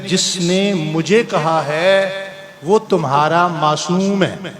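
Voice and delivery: slow emphatic punchline. Shown as a demonstration. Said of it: "slow emphatic punchline".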